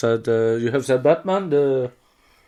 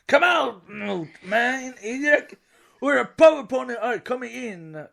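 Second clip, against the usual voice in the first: mock hero taunt; 8 semitones higher than usual. Hollering quote lines.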